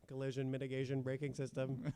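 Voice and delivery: flat robotic monotone. Acted. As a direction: read it like a robot. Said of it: "I love your robot voice".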